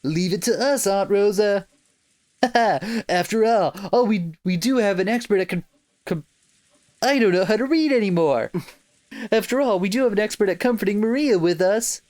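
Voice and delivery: fake Southern accent. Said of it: "this fake Southern accent that I can't do".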